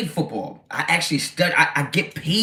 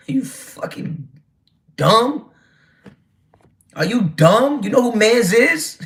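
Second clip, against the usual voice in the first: mocking disbelief; 7 semitones higher, 3 dB louder, slower than usual. Real, not acted. Mocking a chatter.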